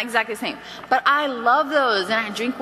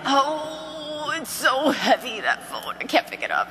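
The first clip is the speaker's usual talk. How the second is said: mock dread; 3 semitones higher, slower than usual. Consultant dreading the booking call, mock-helpless moan. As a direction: groan as if the phone weighs a ton. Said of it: "dreading it".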